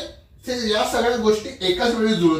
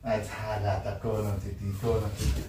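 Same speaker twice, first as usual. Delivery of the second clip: very soft, low echo of the question; low muttering; 12 semitones lower, 10 dB quieter than usual. Acted. Husband repeats wife's question about the girl, low mutter. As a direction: repeat it under the breath.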